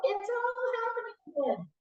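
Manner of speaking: the hurt mind sounding the alarm; panicked inner voice. Retold or imitated. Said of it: "it's gonna go".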